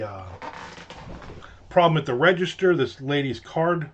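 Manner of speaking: ordinary talk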